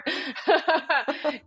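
laugh. Real, not acted. Laughing at own accent.

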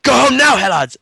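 mock officer barking high and loud; blurting the order sharply, high and loud; imitating a shout. Retelling the officer's dismissal, a mock shout. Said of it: "he just went".

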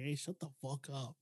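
low, very soft warning; specific tone. Shown as a demonstration. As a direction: drop the voice right down, quietly firm. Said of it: "say it in a specific tone".

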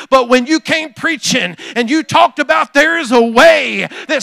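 loud, rising preacher's cadence; preaching at full force. Acted. Pastor voices Peter to the congregation, preaching loud. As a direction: preach it, building every phrase.

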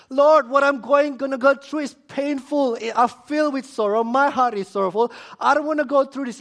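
loud, high, impassioned praying; acted anguished appeal, sustained high and loud; desperate plea. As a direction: beg God at full preaching force. Paraphrases Jesus's desperate plea to God.

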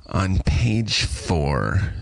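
extremely slow, drawn-out imitation; annoying network executive. Executive reading out her notes, annoyingly slow. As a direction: stretch it out as a tiresome note-giver. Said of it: "as annoying as possible because of her job".

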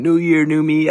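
high, loud mock cheer; hammed-up, high-pitched slogan; mocking everyone. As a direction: parody gushing New Year enthusiasm, ending in a cry. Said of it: "Everyone always says".

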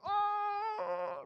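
very high, loud mock "Oh!"; crowd gasp. Kids at the rap battle cheering a burn, high loud whoop.

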